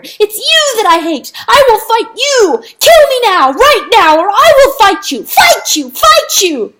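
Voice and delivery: high, very loud frenzied screaming; desperate screaming. A narrator's reading. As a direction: scream a challenge in anguished rage. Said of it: "wept for guilt and screamed the louder".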